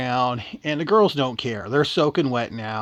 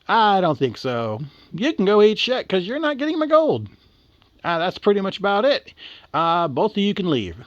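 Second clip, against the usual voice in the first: higher-pitched, lightly acted brush-off; rude refusal; 7 semitones higher, 3 dB louder than usual. Leprechaun guarding his gold from two girls, rude refusal. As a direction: tell them off, mock-indignant.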